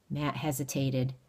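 ordinary delivery

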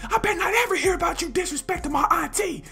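high put-on voice of an angry older cousin; hammed-up furious cousin voice; cousin yelling. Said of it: "He pulled up like".

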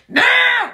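loud, high imitated yowl; mock customer yowl. Customer calling as you clock out, mock yowl. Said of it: "you're just gonna hear".

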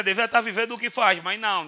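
ordinary talk, nothing marked

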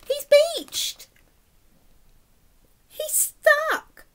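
high, loud, near-crying alarm; pained storyteller's wail; dramatic distress. Acted. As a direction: sound truly upset for the whale. Teacher dramatizes stranded whale.

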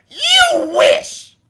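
squeaky high mocking taunt; mock squeal. Mocking Danny with a high squeaky taunt.

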